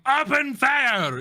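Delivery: high, loud, drawn-out shout; hammed-up firing order; imitated battle cry. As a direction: yell it slowly like a gunner's command. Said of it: "they're just saying".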